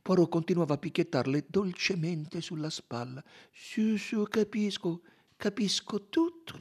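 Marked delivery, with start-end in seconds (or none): 3.5-6.6 high, gentle, unhurried reassurance; soothing. A narrator's reading. Detective patting a sobbing woman's shoulder, soothing. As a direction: hush and comfort, slowing down.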